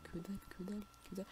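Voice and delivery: soft, repeated grumble; quiet, trailing-off repetition; muttered disappointment. Real, not acted. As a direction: mumble it over and over. Repeats it under the breath.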